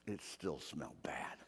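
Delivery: whispered in disgust. Acted. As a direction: breathe it out without voice, disgusted. Disgusted aside about the stink.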